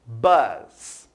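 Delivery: bus said the English way, for students to repeat. Shown as a demonstration. Teacher models bus for the class, in English pronunciation.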